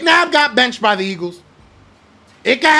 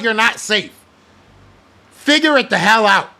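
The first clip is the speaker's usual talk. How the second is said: ordinary talk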